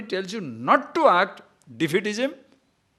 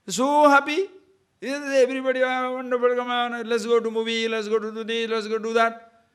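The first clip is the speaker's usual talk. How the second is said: higher, eager mock of a man rushing everyone out; hammed-up overexcited friend; mock excitement; 7 semitones higher, 3 dB louder than usual. Acting an overexcited person.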